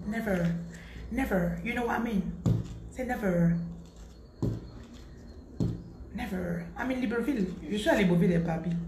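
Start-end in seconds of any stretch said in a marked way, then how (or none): 0.1-1.6 demonstrating never in an English accent; English-accented never with a falling tune. Shown as a demonstration. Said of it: "Dites un peu never avec l'accent". 3.0-6.6 never in a put-on English accent; English-accented never, falling at the end. Shown as a demonstration. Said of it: "Dites un peu never avec l'accent".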